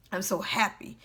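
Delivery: soft, subdued claim of happiness; understated, quietly saying it through hurt. Retold or imitated. Retelling their own self-talk while still down, hurting. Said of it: "I was hurting while I was saying it".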